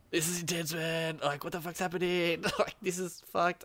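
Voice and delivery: mock dread. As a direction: squeeze it out high as if under bombardment. Retold thoughts during mortar strike.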